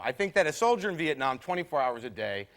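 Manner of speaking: ordinary talk, nothing marked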